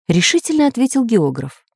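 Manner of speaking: plain reading voice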